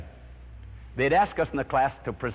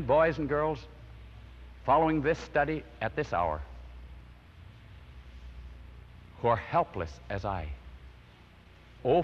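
earnest closing prayer; 3 dB quieter than usual. Acted. Preacher prays for his listeners, reverent and earnest. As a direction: pray softly over the listeners.

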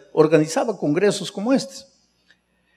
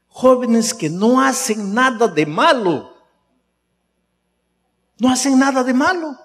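fervent preaching; 9 semitones higher, 5 dB louder than usual. Acted. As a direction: drive the point home, building. Preaching climax.

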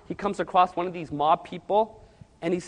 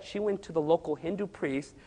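usual speaking voice

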